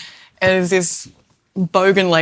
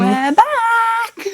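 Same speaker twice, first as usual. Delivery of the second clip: very high, bright sing-song call; cheery call; 5 dB louder than usual. Real, not acted. Echoing her friend's greeting to viewers, high sing-song call. Arriving home, announcing loudly.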